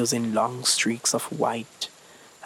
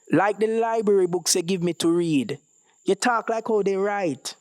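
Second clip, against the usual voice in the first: put-on boy voice; 7 semitones higher than usual. Ezekiel voiced.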